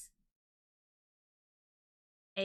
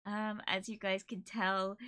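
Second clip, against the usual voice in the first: ordinary talk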